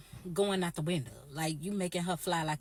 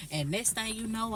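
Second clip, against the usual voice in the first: normal talking voice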